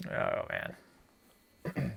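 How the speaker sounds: ordinary talk, nothing marked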